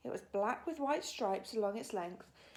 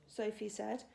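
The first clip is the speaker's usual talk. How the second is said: ordinary delivery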